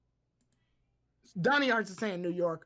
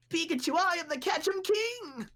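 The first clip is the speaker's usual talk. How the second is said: mock Ash voice; 8 semitones higher than usual. Voicing Ash as Ketchum King.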